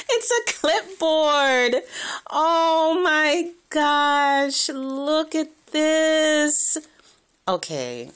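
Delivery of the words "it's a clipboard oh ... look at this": high, loud, slow drawn-out awe; thrilled gushing. Real, not acted. Vlogger showing viewers the gift, high, slow, thrilled gush. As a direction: stretch every word in delight.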